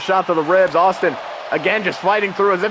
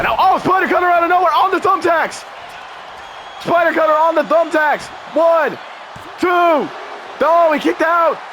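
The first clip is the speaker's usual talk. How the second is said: excited shout; 11 semitones higher than usual. Real, not acted. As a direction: scream the surprise move. Surprise move, near fall.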